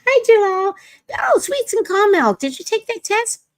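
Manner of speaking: high, bright sing-song hello; high excited greeting. Real, not acted. Greeting callers by name, high sing-song excitement.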